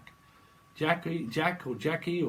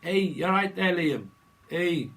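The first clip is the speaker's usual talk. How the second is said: [name] impression; 4 semitones higher, 6 dB louder, slower than usual. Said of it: "You might like [name]".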